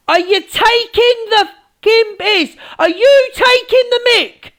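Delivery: high, indignant rising rant; angry outburst. Real, not acted. Confronting Angie over talk about Smiles, angry outburst. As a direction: demand it in outraged disbelief, pitch climbing.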